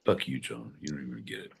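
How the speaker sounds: low soft mutter. Pretend. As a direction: drop it to a grumble. Sudden low muttered aside.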